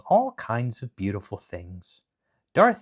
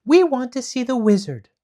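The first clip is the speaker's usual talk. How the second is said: demanding Dorothy; 8 dB louder than usual. Acted.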